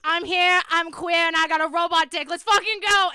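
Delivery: yelled over a roaring crowd; shouting to crowd. Acted. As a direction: belt it out like a rock star. Rock star's final stage line.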